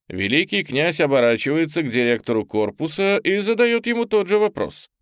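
usual speaking voice

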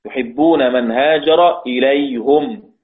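slow recital, إليهم as "ilayhum"; Hamza's recitation. Shown as a demonstration. Teacher quoting a verse in Hamza's reading, إليهم as "ilayhum". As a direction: recite slowly, ending إليهم on "-hum". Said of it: "يقرأ حمزة".

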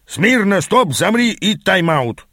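slow, high-pitched orders; emphatic drill-style halt; barked command. A narrator's reading. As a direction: bark each command separately, pitch up. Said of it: "рявкнул Аас".

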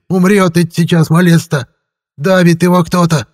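high, loud frantic yell; old man's shout. A narrator's reading. Old grandpa yells at the sorcerer, high and loud. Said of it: "крик деда Силантия".

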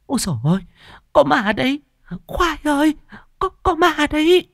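very high panicked cries; terrified scream. A narrator's reading. Mistress crying ghost to the worker, terrified. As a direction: shriek in terror, stammering. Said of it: "kinh hãi hét lên".

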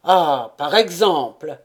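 mild mock alarm; relieved exclamation. A narrator's reading. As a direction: exclaim in relief, a touch of fright left. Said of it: "s'écria l'ingénieur".

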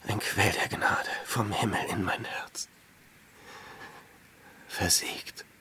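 breathy, voiceless whisper of a weary hermit. Acted. Hermit whispering his lost grace to himself. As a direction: hush it almost to nothing, worn out. Hermit murmuring alone in desert.

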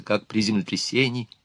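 ordinary delivery

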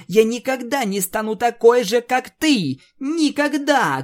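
high, loud, furious refusal; defiant shout. Acted. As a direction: hurl never at her. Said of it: "крикнула Твайлайт". (яростный крик)

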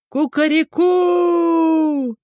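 long high crowing call; rooster crow. A narrator's reading. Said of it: "орал он громко и долго".

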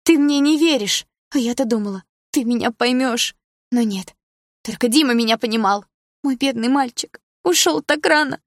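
high, loud tearful wailing; crying on the phone. Acted. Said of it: "Она зарыдала в трубку".

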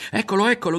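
put-on high, loud boyish cry; excited shout. A narrator's reading. Berto shouts ahead to Ario, spotting the Duke. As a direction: point and shout it out. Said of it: "gridò Berto".

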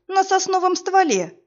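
high, disbelieving exclamation; incredulous. A narrator's reading. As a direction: echo it in utter disbelief. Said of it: "недоверчиво повторил холоп".

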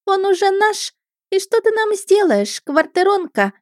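put-on very high, taunting girlish voice; scornful mermaid. A narrator's reading. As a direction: gloat and dare the rival to act. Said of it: "фыркнула та, что с бусами". (презрительная юная русалка, очень высоко)

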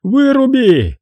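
very high, loud screaming order; frantic shriek. Acted. Хеннесси в безумии орёт на Дантека, пронзительный крик. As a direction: scream it with all you have.

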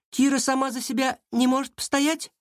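high, mocking question; smirking taunt. A narrator's reading. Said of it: "усмехнулся Власов". (с усмешкой, высоко)

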